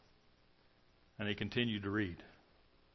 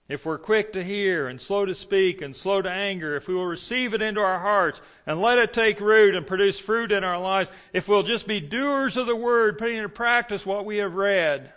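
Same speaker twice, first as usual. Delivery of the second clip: higher, louder fervent preacher; preaching at full force; 7 semitones higher, 7 dB louder than usual. Acted. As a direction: drive the point home to the congregation.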